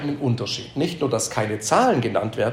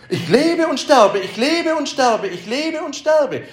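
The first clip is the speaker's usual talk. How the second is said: higher and slow, a drawn-out weary sigh of a refrain; weary lament; 10 semitones higher, 3 dB louder than usual. Pretend. Preacher voicing a hopeless person's weary refrain, slow and high. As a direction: moan it like an endless cycle.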